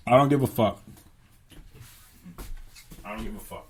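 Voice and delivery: ordinary delivery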